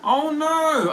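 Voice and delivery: high, loud "oh no"; sudden alarmed reaction; shocked gasp. Real, not acted. Reacting to sad chat news.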